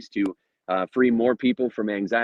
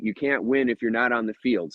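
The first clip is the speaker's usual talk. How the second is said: normal talking voice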